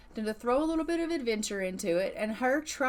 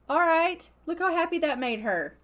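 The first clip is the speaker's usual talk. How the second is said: normal talking voice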